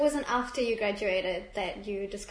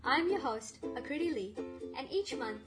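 bouncy scripted podcast intro; sing-song welcome over music; exaggerated cartoon intonation; 4 semitones higher than usual.

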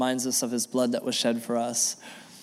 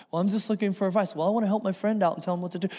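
higher, fast, lightly voiced nosy churchgoer; mock gossiper; 7 semitones higher, faster than usual. Pastor mocking a gossiper who asks for advice, higher and fast. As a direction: play the eager meddler fishing for a story.

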